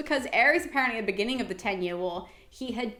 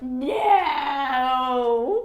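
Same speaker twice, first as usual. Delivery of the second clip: hammed-up wailing no, louder, half laughing; mock agonized wail; dramatic scream; 5 dB louder than usual. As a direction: overact a god's howl of pain. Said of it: "collapsing and screaming with the volume".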